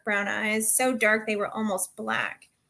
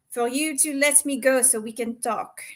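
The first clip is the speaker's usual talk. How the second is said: plain reading voice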